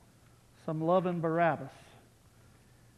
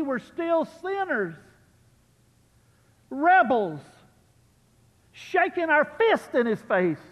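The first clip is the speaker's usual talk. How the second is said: raised, higher preaching voice building on the verse; impassioned pulpit preaching; full-force preaching; 7 semitones higher, 7 dB louder than usual. Acted. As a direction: preach it out to the whole room, louder and higher.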